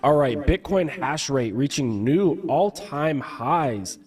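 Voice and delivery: ordinary talk, nothing marked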